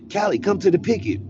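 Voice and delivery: high, loud page over the prison loudspeaker; loudspeaker call. Retold or imitated. Guard paging him to the picket over the loudspeaker. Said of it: "call me on a loudspeaker".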